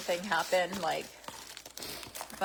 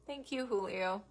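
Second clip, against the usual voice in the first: soft, choked-up thanks; a real, quiet tearful catch; teary; 3 semitones higher, 6 dB quieter than usual. Real, not acted. Thanking Julio for his book, softly and close to tears. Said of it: "I don't know why I'm crying".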